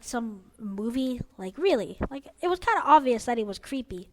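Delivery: ordinary delivery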